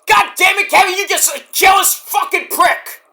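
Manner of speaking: high-pitched exasperated shout; retold angry outburst. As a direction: blow up at him in a mock tirade. Retold exasperated insult at Kevin.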